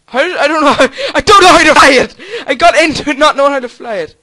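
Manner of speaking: loud, high, rushed protest; panicked shouting. Real, not acted. Gamer panicking at not knowing how to fly it, panicked shouting. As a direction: yell it in a flap. Panics flying an unfamiliar helicopter.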